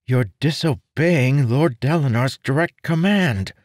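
high, loud, stunned outburst; shocked accusation. Acted. Marin confronts Arador about defying Dalinar, alarmed. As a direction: blurt it out in alarm.

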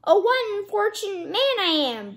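high-pitched storybook lament; lightly acted cry of despair at bad luck; cry of woe. A narrator's reading. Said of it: "he again cried".

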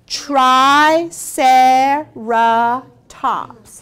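Triceratops split into slow, separate syllables. Shown as a demonstration. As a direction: sound out Triceratops one beat per syllable. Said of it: "Can you say that with me".

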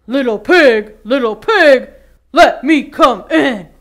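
loud, booming wolf at the door; big bad wolf. Wolf calling to the pig at the straw house, booming. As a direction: call in like a hungry wolf. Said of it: "The wolf growled and said".

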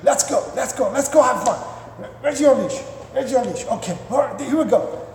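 gee the dog up in a high excited voice; acted giddy owner calling a pet; over-excited dog owner. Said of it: "this is what you do every time you wanna go out".

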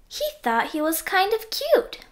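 ordinary delivery

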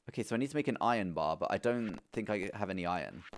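normal talking voice

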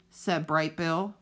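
ordinary delivery, nothing marked

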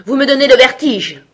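high, loud royal outburst; imperious, exasperated shout; shouted order. A narrator's reading. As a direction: complain at the top of your voice. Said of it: "ordonna la reine".

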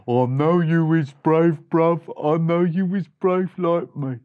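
swaggering mouse backing up his bruv; boastful big brother. Acted. Big brother agreeing with little one, boastful. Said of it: "And the big brother said".

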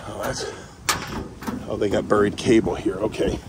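ordinary delivery

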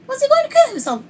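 imitated high-pitched, alarmed question; shocked question. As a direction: ask mommy in high, loud disbelief. Retold shocked question to mommy.

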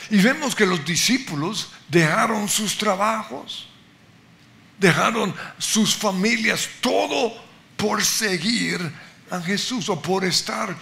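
fervent sermon climax; full-force preaching. Acted. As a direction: drive the point home from the pulpit. Preaching climax on paying price.

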